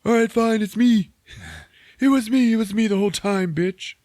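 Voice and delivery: high, loud mock confession; defiant shout. Scientist owning up to the villain, loud and high. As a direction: yell it out like a hammed-up confession.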